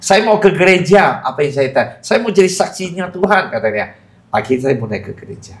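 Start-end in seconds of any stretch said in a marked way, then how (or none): 0.0-1.2 re-enacted vow in a raised pitch; earnest vow. Man retells vowing to go to church, earnest vow. Acting out his vow in prayer. 2.0-3.5 high-pitched re-enacted pledge; earnest vow. Man retells promising to be God's witness, earnest vow. Acting out his vow in prayer.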